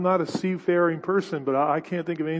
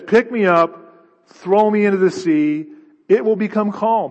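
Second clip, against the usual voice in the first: louder, higher, commanding the sailors; Jonah's command; 4 semitones higher, 6 dB louder than usual. Acted. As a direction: ring it out like a prophet giving orders. Preacher voices Jonah's command.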